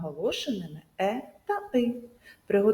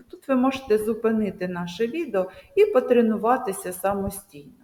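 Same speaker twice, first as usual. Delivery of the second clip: ordinary delivery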